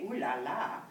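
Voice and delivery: lilting imitation of a French aunt; sing-song French exclamation; French aunt's catchphrase. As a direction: swoop down and back up, playfully French. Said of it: "every time I heard her say".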